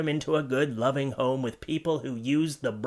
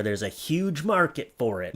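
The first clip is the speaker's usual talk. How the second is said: ordinary talk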